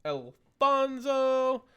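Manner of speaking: high, very slow announcement of a name; drawn-out name call. Real, not acted. Calling out viewer's name for giveaway.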